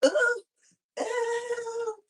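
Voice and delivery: high yelp from aching knees; high pained ooh. Real, not acted. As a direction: squeal with knee pain. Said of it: "give me a knee stretch".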